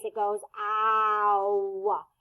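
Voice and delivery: "ow" drawn out slowly, mouth open wide then closing; slow exaggerated ow. Shown as a demonstration. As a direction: stretch "ow" from wide open to closed. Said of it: "your mouth moves in two different ways".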